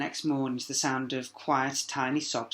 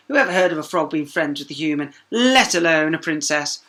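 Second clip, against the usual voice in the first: louder, slowing at the end; scornful princess; 2 semitones higher, 10 dB louder than usual. A narrator's reading. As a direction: sneer as the proud princess. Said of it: "the princess called back".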